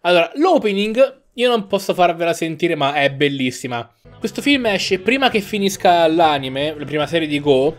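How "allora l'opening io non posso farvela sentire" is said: ordinary talk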